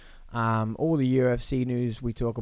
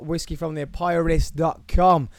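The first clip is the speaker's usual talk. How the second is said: normal talking voice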